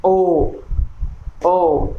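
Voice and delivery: ओ said half open with rounded lips; repeated vowel demo. Shown as a demonstration. Teacher repeating the open ओ vowel for students. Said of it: "यहाँ भी हमारा mouth half open है".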